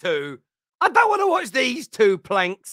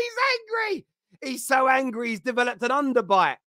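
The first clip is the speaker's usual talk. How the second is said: ordinary talk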